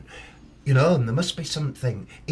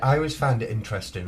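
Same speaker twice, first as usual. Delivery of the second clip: ordinary delivery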